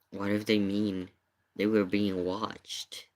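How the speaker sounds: ordinary talk, nothing marked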